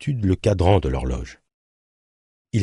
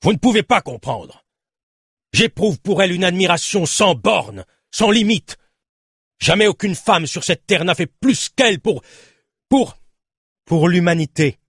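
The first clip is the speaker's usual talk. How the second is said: voix très haute, emportée; déclame avec ferveur, en butant sur la fin; exaltation passionnée; 12 semitones higher than usual. A narrator's reading. Nemrod s'emporte, aveu passionné. (exalté, passionné)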